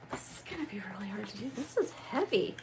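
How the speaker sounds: fast breathless whisper of awe; awed whisper. Real, not acted. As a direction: whisper it as the box opens. Opening the heavy box.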